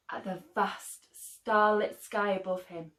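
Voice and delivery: ordinary delivery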